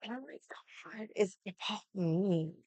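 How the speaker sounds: whispered, mocking his self-pity. Mocking the guy who thinks it's about him, whispered. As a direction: breathe it like a sulky whiny boy. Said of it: "he was just like".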